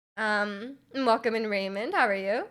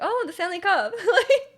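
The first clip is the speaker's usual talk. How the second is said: amused realisation; 8 semitones higher, 4 dB louder than usual. Retold or imitated. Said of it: "I was just very confused".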